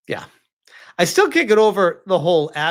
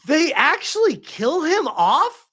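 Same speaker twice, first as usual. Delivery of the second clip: higher, slow, disbelieving wife voice; imitating wife's shock; 10 semitones higher, 4 dB louder, slower than usual. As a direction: play the stunned wife, pitched up. Husband voicing wife's shocked reaction.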